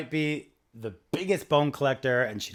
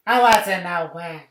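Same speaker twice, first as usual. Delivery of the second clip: louder, slow, high clerk voice; mock prissy clerk; 6 semitones higher, 12 dB louder, slower than usual. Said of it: "he's like".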